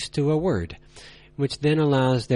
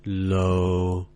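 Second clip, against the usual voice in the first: "low," held deep, lower and flat; low level tone; 5 semitones lower than usual. Shown as a demonstration. Teacher showing learners the third tone, low and flat. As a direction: drop "low," to the bottom and hold it. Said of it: "Yes, it would be".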